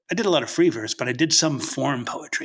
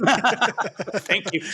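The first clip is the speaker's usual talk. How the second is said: laughing; 9 semitones higher than usual. Real, not acted. Laughs while thanking.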